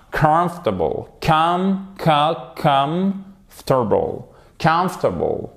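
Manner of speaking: "Comfortable" with high stressed KUM, falling tail; first-syllable stress. Shown as a demonstration. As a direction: drill "Com-" high, then KUMF-tuh-bul. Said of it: "il faut savoir le prononcer".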